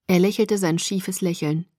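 usual speaking voice

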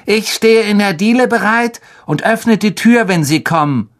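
high put-on boy's voice; dutiful rehearsed son. A narrator's reading. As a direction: recite your party role in a high voice. Son recites his rehearsed part.

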